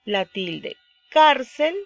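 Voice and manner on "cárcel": "Cárcel" with a loud, raised CÁR; stress on CÁR. Shown as a demonstration. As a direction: hit the A in the first syllable of "Cárcel". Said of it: "la mayor fuerza de voz está en la A".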